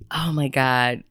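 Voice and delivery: normal talking voice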